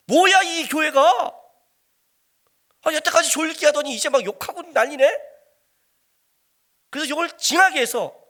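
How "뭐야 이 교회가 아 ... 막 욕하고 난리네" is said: raised, high complaint of an outraged churchgoer; indignant protest. Acted. Churchgoer reacting to being cursed from the pulpit, indignant. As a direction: act out a loud, affronted grumble.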